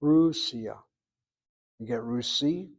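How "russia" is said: "Russia" as RUS-ya, RUS punched louder; first-syllable stress. Shown as a demonstration. Teacher repeating the model, "Russia" stressed on first syllable. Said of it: "with the emphasis on that first syllable".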